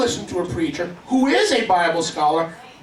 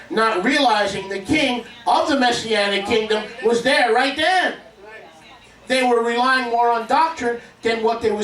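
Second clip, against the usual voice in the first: rising, higher preaching build; 6 semitones higher than usual. Acted. Preacher urging the congregation to see with spirit, rising preaching. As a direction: swell the sermon toward its climax.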